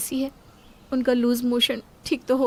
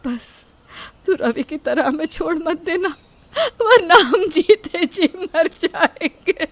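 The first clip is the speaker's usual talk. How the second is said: crying, slowing and rising; a mother pleading with her son, weeping; tearful plea; 4 semitones higher than usual. Acted. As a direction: beg through tears not to be abandoned.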